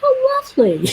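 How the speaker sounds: squeaky high, mock-sweet how lovely. Real, not acted. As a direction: chirp it with a forced smile. Reacting to friend's news.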